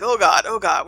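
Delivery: loud mock panic; retold fretful outburst; freaking out. Teacher imitates Bob distressed at shocking someone, loud mild panic. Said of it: "and he's freaking out".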